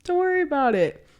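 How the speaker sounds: high, breezy brush-off; carefree, lilting put-on voice; easygoing reassurance. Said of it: "which is, like, "Take it easy".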